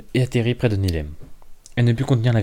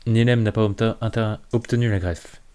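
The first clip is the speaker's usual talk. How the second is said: plain reading voice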